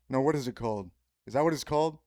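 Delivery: normal talking voice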